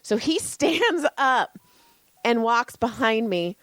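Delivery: usual speaking voice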